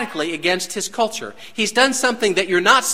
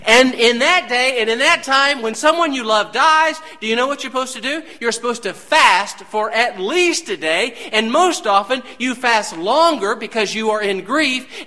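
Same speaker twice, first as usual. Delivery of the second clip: preaching at full force; 6 semitones higher than usual. Acted. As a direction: build the sermon to a peak. Preaching build, raised voice.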